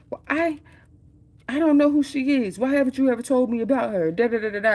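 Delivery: high, rising accusing wife's voice; wife's indignant accusation. Retold or imitated. Jealous wife grilling her husband on the phone, in a high indignant voice.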